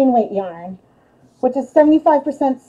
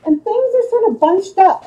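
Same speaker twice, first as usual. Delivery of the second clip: ordinary talk, nothing marked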